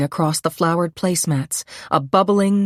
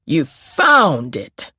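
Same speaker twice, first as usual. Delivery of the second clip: slow, louder sneer; a detective mocking her alibi with scorn; dripping scorn; 4 dB louder, much slower than usual. A narrator's reading. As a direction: drag it out with contempt. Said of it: "His voice dripped with scorn".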